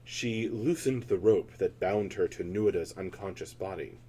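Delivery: ordinary delivery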